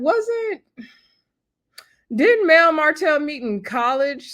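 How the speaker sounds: high, puzzled question; can't square the timeline, pitch jumps up; baffled surprise. Real, not acted. Vlogger puzzling over the show's timeline, surprised.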